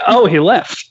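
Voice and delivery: ordinary delivery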